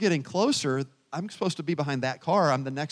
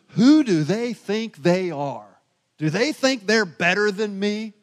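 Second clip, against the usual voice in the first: offended indignation; 5 semitones higher, 6 dB louder, slower than usual. Retold or imitated. Voicing an offended driver's thought.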